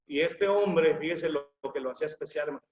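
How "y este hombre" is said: ordinary delivery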